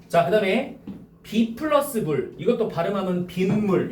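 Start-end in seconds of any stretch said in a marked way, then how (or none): 3.3-3.9 빗물 as bin-mul, with n before m; demonstrated 빗물. Shown as a demonstration. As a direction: sound a clear n in 빗물. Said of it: "이렇게 발음되지". ("빈물"로 니은 소리를 넣어서)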